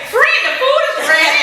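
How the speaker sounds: high, sing-song friend voice; mock friend text. Reading out the friend's text in a high cheerful voice. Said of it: "she texts me".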